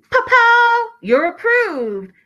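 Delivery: Pow shot up high and loud; hammed-up announcement, pitch leaping on Pow; mock excited reveal. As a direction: burst it out like a dramatic reveal. Acting out the approval screen.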